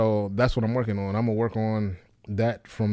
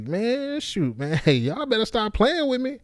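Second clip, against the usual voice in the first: much higher, bouncy, laughing through it; higher, livelier voice; 13 semitones higher than usual. Shown as a demonstration. Comic trying a new pitch, high and teasing. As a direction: push the pitch up like a playful scold. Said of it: "I gotta be like".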